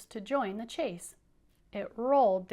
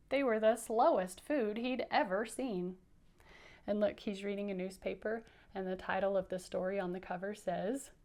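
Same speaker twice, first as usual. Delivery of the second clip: usual speaking voice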